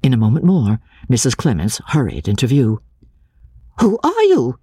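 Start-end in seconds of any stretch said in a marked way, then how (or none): 3.8-4.6 a high, startled cry of challenge; sharp cry. A narrator's reading. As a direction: call out high, demanding who's there. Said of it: "she cried".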